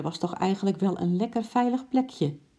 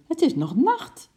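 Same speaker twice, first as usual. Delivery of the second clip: high, loud alarm rising at the end; wife's excited, raised voice; excited cry; 13 semitones higher, 5 dB louder than usual. A narrator's reading. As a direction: exclaim it in high agitation. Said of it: "riep ze opgewonden".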